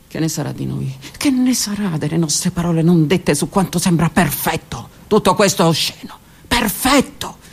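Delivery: ordinary delivery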